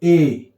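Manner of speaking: ए read alone as a full "ay"; strong form. Shown as a demonstration. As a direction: say ए as the letter name "ay". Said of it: "यहां इसको हम पढ़ेंगे". (ए, अकेले पूरे स्वर में)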